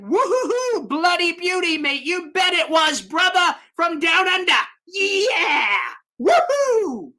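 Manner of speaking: high, hammed-up Australian cheer; whooping mock Australian. Host hails a fan from down under, mock Australian celebration. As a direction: whoop it up in a put-on Aussie accent.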